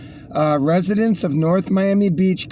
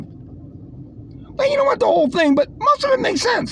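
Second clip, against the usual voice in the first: very high mock concession. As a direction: concede in a shrill mocking tone. Mocking voice again.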